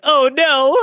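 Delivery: high-pitched imitation of a worried wife; mock dismay. Husband voicing wife who saw him get emotional, mock dismay. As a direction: raise the voice high in playful dismay. Voices wife realizing what is coming.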